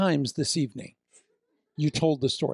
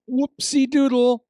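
put-on playful baby talk; 9 semitones higher, 6 dB louder, slower than usual. Shown as a demonstration. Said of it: "as we say to Arwen".

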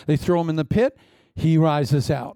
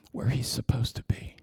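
hushed whisper; 4 semitones lower, 6 dB quieter than usual. Real, not acted. Preacher whispering to the congregation, hushed. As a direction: drop to a whisper, leaning in.

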